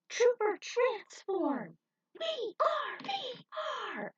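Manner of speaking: very high, slow, ringing hero call; transformation battle cry. A narrator's reading. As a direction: shout the transformation proudly.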